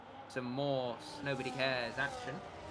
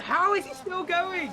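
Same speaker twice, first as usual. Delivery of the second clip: high, slow astonished call; motorsport commentator; 8 dB louder, slower than usual. Real, not acted. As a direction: draw it out in disbelief. Disbelief at car still running.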